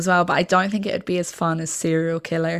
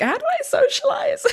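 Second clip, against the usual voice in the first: high, squeaky and flustered; awkward squeaky voice. Retold or imitated. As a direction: act out being lost for words. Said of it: "Whereas I'm like".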